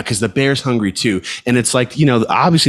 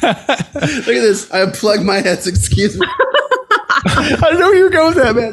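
high, squeaky talking through laughter; real helpless laughter. Real, not acted. Cracking up at co-host's joke and unplugging headset, helpless laughter. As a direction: barely get the words out for laughing.

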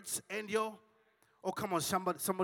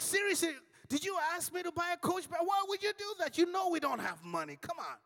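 high, loud, disbelieving yell; outraged husband shouting at wife; seriously, why would you do that; exasperated shout; 12 semitones higher, 3 dB louder than usual. Retold or imitated. Husband yells in retold quarrel.

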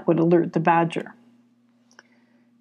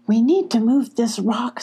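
usual speaking voice

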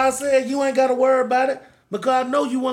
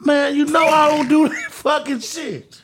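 raised, higher-pitched protest; indignant refusal; 4 semitones higher than usual. Real, not acted. Co-host rejecting a provoking hypothetical, indignant refusal.

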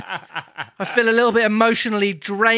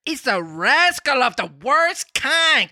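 high, slow, drawn-out cricket voice; a tiny insect judge, raised high and unhurried; talking cricket voice; 7 semitones higher, slower than usual. Acted. Reading Pinocchio aloud, the cricket speaks in a high voice. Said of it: "that voice is too good".

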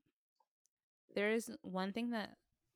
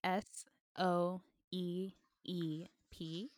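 very slow, letter by letter; spelled out; much slower than usual. Shown as a demonstration. Co-host spelling the Airbnb's name for listeners, spelled out slowly. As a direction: spell it out with gaps between the letters. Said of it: "it's spelled".

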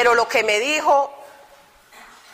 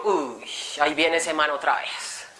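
muttered aside; 6 semitones lower, 6 dB quieter, slower than usual. Retold or imitated. Inner groan at the brother.

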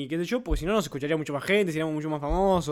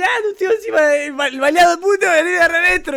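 high, loud jeering imitation; mocking taunt; 14 semitones higher, 10 dB louder than usual. Imitating friends joking about his shot uncle, loud mockery. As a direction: jeer it shrilly, hammed-up. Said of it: "Y me dicen".

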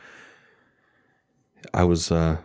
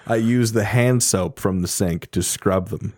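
ordinary talk

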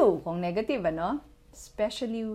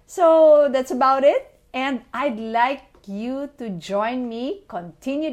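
excited; 4 semitones higher, 9 dB louder than usual. Real, not acted. Upbeat podcast sign-off.

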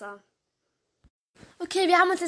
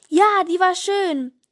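delighted call; 4 semitones higher, 8 dB louder, slower than usual. Acted. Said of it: "strahlen bis über die Ohren und rufen".

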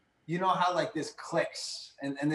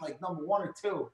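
normal talking voice

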